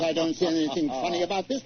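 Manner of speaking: normal talking voice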